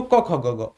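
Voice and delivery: "क, ख, ग, घ" with rounded o vowels; wrong letter names. Shown as a demonstration. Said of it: "ये दोनों ही उच्चारण इसके गलत हैं".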